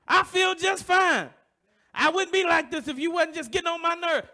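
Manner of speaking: a sarcastic, heated protest acted out as a sermon example; mock angry insistence. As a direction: play the touchy man who blames others. Acted angry person denying anger.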